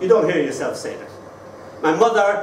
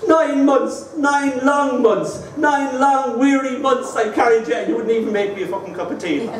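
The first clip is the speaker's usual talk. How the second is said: mother's guilt trip, extended; 8 semitones higher than usual. Retold or imitated. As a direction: stretch out nine long months, hammed up. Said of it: "then she'd extend it".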